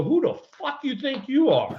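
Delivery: high, furious mock yell at the pitcher; retold furious yell. Player retelling how he yelled at the young pitcher, mock furious. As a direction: snap it out high, retelling the fury. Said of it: "I go".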